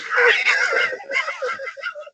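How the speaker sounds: howling laugh; burst of laughter. Real, not acted. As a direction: crack up hard at the punchline. Big laugh at the story.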